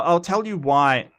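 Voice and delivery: usual speaking voice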